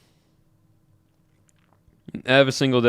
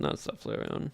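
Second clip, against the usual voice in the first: whisper; 14 dB quieter than usual. Real, not acted. Echoing the Flareon pick.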